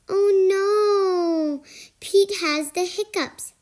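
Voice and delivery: ordinary delivery, nothing marked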